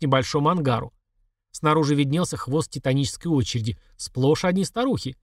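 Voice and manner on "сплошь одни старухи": ordinary delivery, nothing marked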